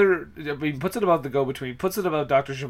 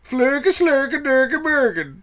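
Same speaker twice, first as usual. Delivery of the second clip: high, drawn-out Swedish gibberish; high-pitched fake Swedish nonsense voice; mock Swedish; 9 semitones higher, slower than usual. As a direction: put on a silly singsong Swedish accent. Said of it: "I almost thought it was Swedish".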